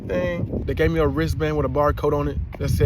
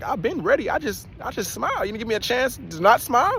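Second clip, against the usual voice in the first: 1 inner protest; 7 semitones higher than usual. Retold or imitated. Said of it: "in my mind, I'm like".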